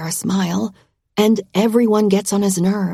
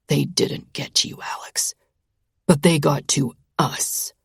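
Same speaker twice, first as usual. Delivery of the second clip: lower, hushed and heavy; low grim male voice; 5 semitones lower, 3 dB quieter than usual. A narrator's reading. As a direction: say it darkly, barely voiced. His grim reply.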